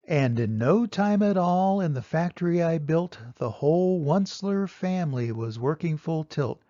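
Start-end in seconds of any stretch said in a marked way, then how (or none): none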